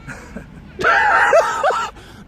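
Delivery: high burst of laughter; laughing. Real, not acted. Auditioner after his Irish attempt, laughing hard. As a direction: crack up at your own attempt.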